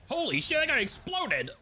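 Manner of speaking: loud, high, shocked; startled, excited yell; shocked shout. Real, not acted. Sees enemy blow up.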